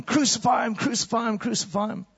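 high mob yell dying down; crowd screaming. Acted. Crowd screaming for crucifixion, high then fading. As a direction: shout it like a baying mob. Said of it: "they're shouting and screaming".

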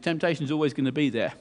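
normal talking voice

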